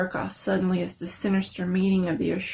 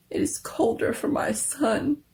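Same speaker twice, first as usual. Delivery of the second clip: a touch of tearful grief, higher; mild grieving reply; weeping; 4 semitones higher than usual. A narrator's reading. Grieving mother answering her husband, mildly tearful. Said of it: "and wept afresh".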